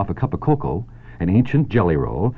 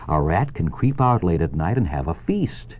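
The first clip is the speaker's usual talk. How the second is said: ordinary talk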